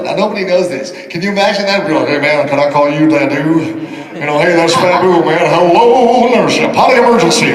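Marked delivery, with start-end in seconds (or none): 2.1-7.5 booming rock-and-roll singer impression.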